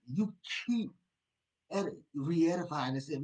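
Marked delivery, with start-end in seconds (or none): none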